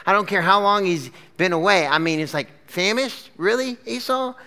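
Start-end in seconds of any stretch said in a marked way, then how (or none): 2.7-4.3 high-pitched skeptical questioning; mock disbelief. Preacher doubting hungry Esau's claim, incredulous. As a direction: question it as if he's exaggerating.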